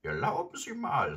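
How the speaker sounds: put-on high, loud indignant old lady; indignant grandmother. A narrator's reading. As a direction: protest in a high-pitched huff. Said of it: "rief sie entrüstet".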